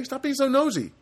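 high-pitched mock telling-off; mock scolding. Host to prying listeners, mock scolding. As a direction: raise the voice in pretend indignation.

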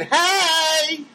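high, drawn-out goofy greeting; Jerry Lewis voice. Retold or imitated. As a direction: stretch it out high like a manic slapstick comic. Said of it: "Won't sound like Jerry Lewis".

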